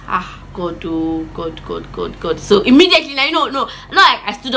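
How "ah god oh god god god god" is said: retold hushed, low plea to God; fearful muttering. Child caught by mom, muttering to God in dread. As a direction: softly mutter a dread-filled prayer.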